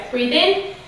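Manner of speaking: slow guided breath count. Shown as a demonstration. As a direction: count the breaths calmly and slowly. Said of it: "So we'll do it together".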